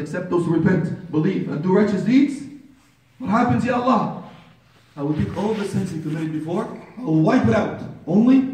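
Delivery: preacher building up good news; rousing preaching. Real, not acted. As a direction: lift each phrase toward a high, ringing question. Preaches the promise of forgiveness.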